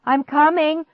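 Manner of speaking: high, loud urgent reply; yell. A narrator's reading. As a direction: yell back in a hurry. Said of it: "I yelled".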